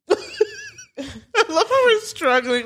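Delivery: slow high laughing remark. Real, not acted. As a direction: laugh through it, slowly. Laughing at struggle.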